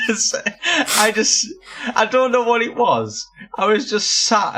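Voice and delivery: high, laughing through words; giggling so hard it breaks up. Real, not acted. Laughing while telling the story.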